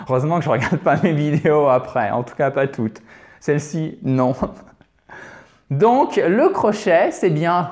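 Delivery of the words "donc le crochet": usual speaking voice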